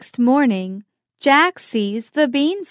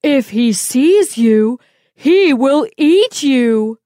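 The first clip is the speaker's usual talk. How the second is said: urgent giantess; 4 dB louder than usual. Acted. Giant woman warning Jack before her husband sees, urgent. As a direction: hurry him into hiding, loud.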